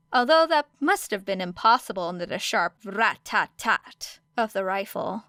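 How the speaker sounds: ordinary delivery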